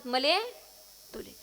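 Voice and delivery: Buldhana "मले तुले" ending in e; Buldhana dialect. Shown as a demonstration. Kirtankar shows how Buldhana side says it, Buldhana dialect. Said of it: "Buldhana साईडला आलो की ते म्हणतात".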